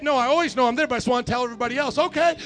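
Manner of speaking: loud, high, excited pulpit shouting; fired-up preacher acting out an eager exchange; full-force preaching. Real, not acted. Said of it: "when I preach like that".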